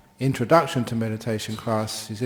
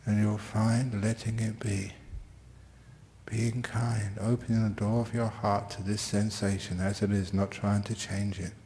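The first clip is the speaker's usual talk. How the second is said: guided meditation; 3 dB quieter than usual. Real, not acted. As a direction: ease listeners into stillness gently. Leads the class into meditation.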